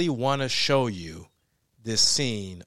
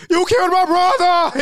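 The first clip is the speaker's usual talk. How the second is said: much higher, loud anguished yell; grieving rage; 9 dB louder than usual. Acted. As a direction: scream it at the killer. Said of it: "he's just like".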